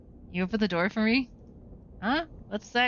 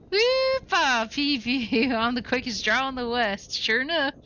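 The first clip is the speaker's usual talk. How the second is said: mock cowboy; 4 semitones higher than usual. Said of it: "Gabby doesn't talk like that".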